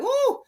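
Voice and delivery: sudden high woo as a chill hits; energy rush woo. Real, not acted. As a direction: let out a high startled woo. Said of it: "Oh, I'm getting some kind".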